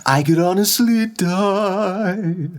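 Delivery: hammed-up tragic singing; mock dramatic sung line. Shown as a demonstration. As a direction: overplay the despair for laughs. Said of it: "in a mock dramatic tone".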